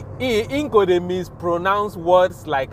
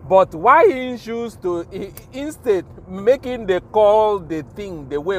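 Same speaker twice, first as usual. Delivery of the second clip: ordinary delivery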